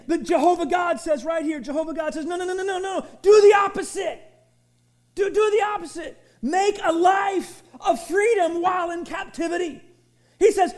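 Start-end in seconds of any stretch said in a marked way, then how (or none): 2.3-9.8 loud, high, insistent preaching; forceful command. Acted. Preacher voicing Jehovah's command to the congregation, loud. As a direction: proclaim it like God's own order.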